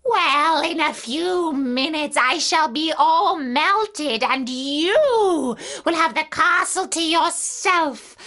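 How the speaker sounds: despairing witch, swooping high then sinking; melting wicked witch. Acted. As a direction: draw out the dying speech theatrically. Witch's dying speech.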